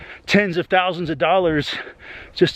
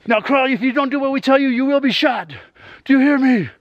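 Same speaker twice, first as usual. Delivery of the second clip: higher, commanding cop voice; barking cop orders; 8 semitones higher than usual. Retold or imitated. Cop yelling orders at a scared kid, barking commands. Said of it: "barking this or that".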